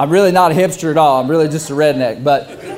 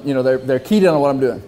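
ordinary talk

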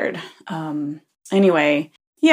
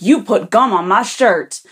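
louder mock-outraged accusation; upset man; 5 dB louder than usual. Teller mimics man insisting the girl did it, upset. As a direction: imitate an indignant man, punching the words. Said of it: "he starts getting upset".

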